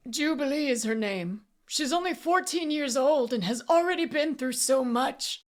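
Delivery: high, pleading voice; pitying distress. A narrator's reading. Auctioneer describing the taken girl, distressed. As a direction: tell it with anguished pity. Frightened man pities the girl.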